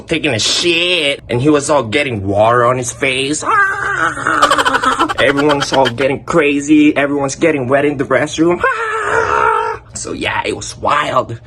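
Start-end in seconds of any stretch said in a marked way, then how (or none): 3.5-5.1 high, yelling burst of laughter; real shrieking laughter. Real, not acted. Everyone getting wet. 8.7-9.9 high, yelling burst of laughter; real shrieking laughter. Real, not acted. Everyone getting wet.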